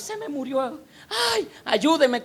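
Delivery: acted-out high-pitched wail for help; desperate plea. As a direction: briefly mimic a mother in despair. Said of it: "por la calle desesperada". (súplica desesperada, imitada)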